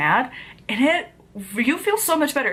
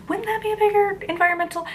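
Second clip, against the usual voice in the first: higher, fast, incredulous; sarcastic retort; 12 semitones higher, faster than usual. Retold or imitated. Vlogger answering the book's claim, sarcastic. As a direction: fire back in mock disbelief.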